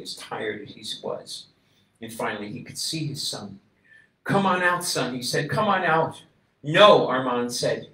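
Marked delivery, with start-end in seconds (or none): 4.2-6.2 higher, louder call into the rubble. A narrator's reading. Father calling his trapped son out, loud and high. 6.6-7.1 much louder, firm refusal; refuse, brave and firm. A narrator's reading. Boy refusing to come out first, loud.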